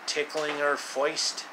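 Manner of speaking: plain reading voice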